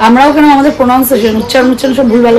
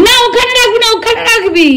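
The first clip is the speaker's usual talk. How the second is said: high scolding; 10 semitones higher than usual. Real, not acted. Scolds the girl over the plate.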